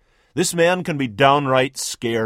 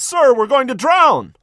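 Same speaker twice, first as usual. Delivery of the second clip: higher, louder panicked bellow; 10 semitones higher, 6 dB louder than usual. Acted. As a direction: bellow it over the storm. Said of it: "One bellows".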